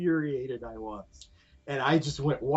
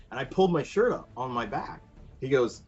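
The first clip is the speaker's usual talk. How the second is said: usual speaking voice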